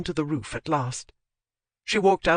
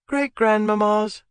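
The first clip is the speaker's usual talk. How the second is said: bored; 5 semitones higher, 5 dB louder than usual. A narrator's reading. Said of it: "in a bored voice".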